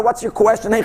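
normal talking voice

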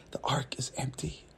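sudden drop to a near-whisper; hushed reveal. A narrator's reading. Storyteller revealing the empty ark, hushed wonder. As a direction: let the discovery land in a hush.

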